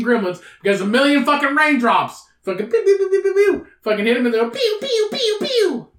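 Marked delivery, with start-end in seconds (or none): 2.7-3.7 high run of imitated zaps; rapid pew noises. Imitating raindrops hitting gremlins. 4.5-5.9 high falling zap noises; pew sound effects. Acted. Imitating raindrops hitting gremlins.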